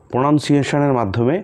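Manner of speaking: ordinary talk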